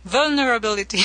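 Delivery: light French accent, said on request; vulnerability with a French lilt. Shown as a demonstration. Interviewer repeats it for the host, French accent. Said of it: "Say vulnerability again".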